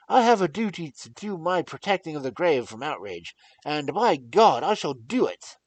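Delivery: loud, high, raging defiance; furious protest. Acted. Young lord furiously forbids the doctor to touch the grave. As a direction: rage at him with full force. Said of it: "in a storm of passion".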